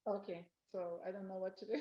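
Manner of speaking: far from the microphone; very quiet, distant Zoom voice; faint audio. Real, not acted. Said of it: "I mean, very low".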